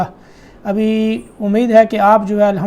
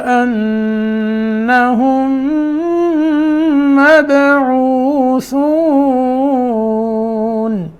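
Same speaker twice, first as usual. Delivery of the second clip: very slow, drawn-out chant; slow tajweed recitation; 2 semitones higher, much slower than usual. Shown as a demonstration. Teacher reciting the whole verse, very slow. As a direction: recite slowly, holding the ghunna. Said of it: "یہاں دیر لگائیں گے".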